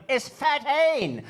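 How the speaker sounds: very high, loud, drawn-out mock English "thirteen"; aggressive English accent. Comic mocking English friends, spit-flying aggressive English accent. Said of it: "really weird, aggressive, spit flying".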